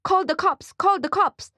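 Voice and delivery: very high, urgent repeated plea; panicked. Acted. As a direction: beg for the cops in panic. Frightened shop owner pleading.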